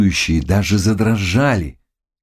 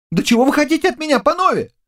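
high, fast, panicky youth; frightened cry; much faster than usual. Acted. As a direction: wail it hurriedly at your accusers. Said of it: "кричал перепуганный юноша". (испуганный крик юноши, скороговоркой)

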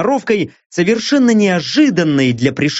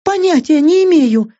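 much higher female character voice; Лаура; 11 semitones higher, 3 dB louder than usual. A narrator's reading. Лаура admitting to Мия she can't help, high-voiced. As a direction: admit it helplessly, pitched high. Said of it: "призналась Лаура".

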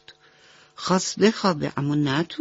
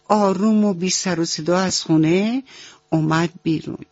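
usual speaking voice

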